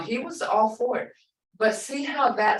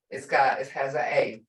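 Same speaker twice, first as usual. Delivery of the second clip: ordinary delivery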